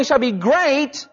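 normal talking voice

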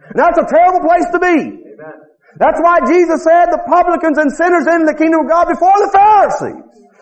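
high-pitched, driving revival preacher; full-force preaching. Real, not acted.

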